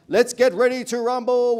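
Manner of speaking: booming, high ring announcer call; Michael Buffer. Acted. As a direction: belt it out to the whole arena. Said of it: "And Michael Buffer".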